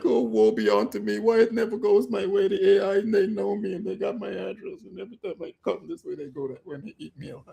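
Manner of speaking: hammed-up whiny self-pity, fading to a near-silent mumble; mock woe. Imitating the trader he'd have been, moaning in the corner. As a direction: lament like a sulking loser in the corner. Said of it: "I'd have been down in the corner like".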